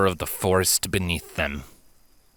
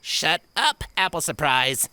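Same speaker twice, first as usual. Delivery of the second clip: annoyed filly; 7 semitones higher than usual. Acted. Annoyed reply to teasing friend.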